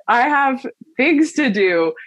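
exasperated, laughing brush-off; annoyed at feelings. Retold or imitated. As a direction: playfully tell your feelings to get lost. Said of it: "I'm so annoyed with them".

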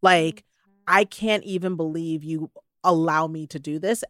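normal talking voice

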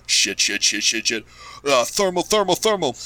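panicked scientists. Acted. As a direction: panic rising into a high yelp. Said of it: "are just like".

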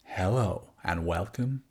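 slow, smooth radio presenter; Smooth FM style. Acted. Co-host asked to redo the intro, slow Smooth FM style. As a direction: ease into it like a late-night radio host. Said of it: "do it Smooth F M style".

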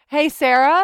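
excited. Acted. Podcast intro greeting.

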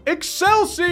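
high rallying battle cry; yell. Acted. Lucanus yells a rallying cry to the marchers. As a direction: shout it to the crowd. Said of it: "Lucanus yells".

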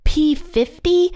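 high, slow puzzled question; confused guess. Retold or imitated. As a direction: guess wrong, sounding lost. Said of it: "they're like".

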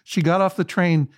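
normal talking voice

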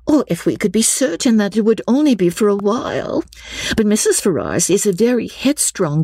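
high character voice, fretting and explaining; Lucy, anxious schemer. Acted. Reads Lucy's dialogue in character.

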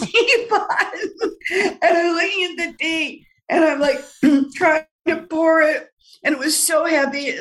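giggling through the words, voice climbing high; laughing while telling. Real, not acted. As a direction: laugh it out as you recall the struggle. Struggling with a heavy teapot.

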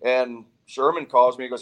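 ordinary talk

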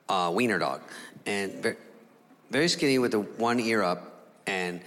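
ordinary delivery

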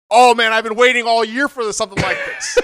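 loud, high relish, lightly hammed up; mock eagerness. Chef mimics the eager knife fighter, loud mock excitement. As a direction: imitate someone thrilled for a fight.

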